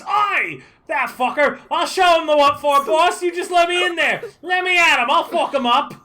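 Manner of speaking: high, loud, shouted mob rage; an enraged familiar begs his boss to let him at the man; furious goon. Acted. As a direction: yell it like a thug itching for a fight. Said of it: "makes a sound in your mind that goes".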